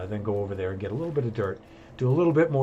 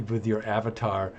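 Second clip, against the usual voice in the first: usual speaking voice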